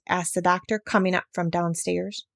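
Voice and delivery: usual speaking voice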